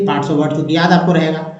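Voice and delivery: ordinary talk, nothing marked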